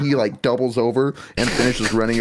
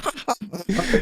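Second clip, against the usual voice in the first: high laugh; 8 semitones higher, 4 dB quieter than usual. Real, not acted. Player recalling the shot, laughing hard. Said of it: "I'm laughing".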